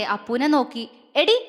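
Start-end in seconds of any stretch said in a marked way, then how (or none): 1.2-1.4 angry call. A narrator's reading. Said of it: "ദേഷ്യത്തോടെ വിളിച്ചു".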